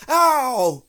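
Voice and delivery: loud, high yelp; pained cry. A narrator's reading. Pooh cries out as Rabbit pulls, pained cry. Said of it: "cried Pooh".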